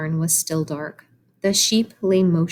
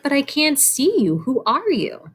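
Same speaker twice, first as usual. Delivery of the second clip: put-on young pig voice; 8 semitones higher than usual. Child voicing puzzled Wilbur.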